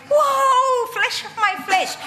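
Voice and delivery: Adam gasping in wonder at Eve; awestruck Adam. Retold or imitated. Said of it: "and he's like".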